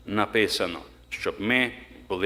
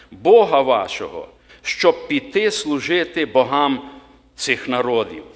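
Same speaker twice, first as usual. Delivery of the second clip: slow, higher, louder reading of the Bible verse; solemn scripture reading; 3 semitones higher, 4 dB louder than usual. Acted. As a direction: read it out like a preacher proclaiming the Word, slowly. (урочисте читання Писання)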